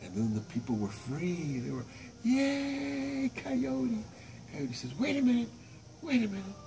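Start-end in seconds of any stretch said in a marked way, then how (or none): 2.2-4.0 high happy cheer. Acted. People cheer. 5.0-6.6 coyote interrupting. Acted. Coyote stops them.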